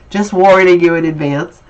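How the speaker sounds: ordinary talk, nothing marked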